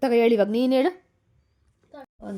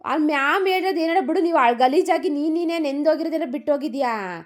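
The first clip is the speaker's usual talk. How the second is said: high, louder exasperated pleading; pleading with daughter; 6 semitones higher, 4 dB louder than usual. Real, not acted. Mother pleading with her sulking daughter, high and insistent. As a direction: raise your voice to reason with her. Scolding-coaxing daughter over dress.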